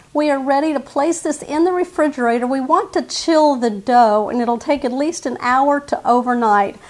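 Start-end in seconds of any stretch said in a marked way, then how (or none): none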